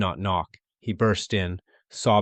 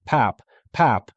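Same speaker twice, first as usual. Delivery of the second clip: raised, higher cry; 3 semitones higher, 5 dB louder than usual. A narrator's reading. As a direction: call out for his father.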